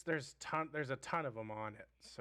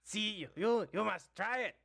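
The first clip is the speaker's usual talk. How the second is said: goblin voice. Acted.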